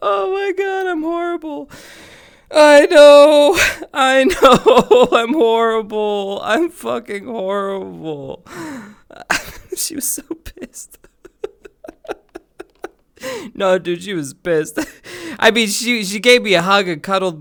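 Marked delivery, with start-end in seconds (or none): 0.1-11.1 high, loud words squeezed out through laughter; real helpless laughter; laughing hard. Real, not acted. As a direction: crack up while confessing. Laughing at his own prank.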